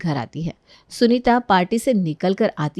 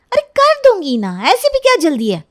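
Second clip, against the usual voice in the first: put-on higher, louder dismissive girl; careless teen girl; 10 semitones higher, 4 dB louder than usual. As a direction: brush off the nagging. Sunita brushing her mother off. (माँ को टालती लापरवाह लड़की)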